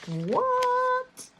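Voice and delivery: long, very high squealed "what"; mock disbelief. Vlogger finding money left in the budget, high astonished squeal. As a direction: draw it out in high-pitched astonishment.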